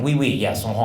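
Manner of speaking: French priest agreeing; French accent. Retold or imitated. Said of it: "there's a priest visiting from France".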